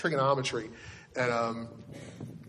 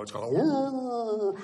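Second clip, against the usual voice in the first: long, high, slow dog's "woo"; imitating husky howl; 9 semitones higher, much slower than usual. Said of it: "it just talks to me".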